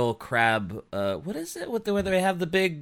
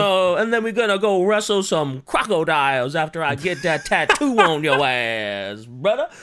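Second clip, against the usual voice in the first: mock Cajun; 2 semitones higher, 4 dB louder than usual. Cajun bragging about wrestling crocodiles, loud mock Cajun accent. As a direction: go big and broad as a Cajun braggart. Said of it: "you sounded a little Cajun".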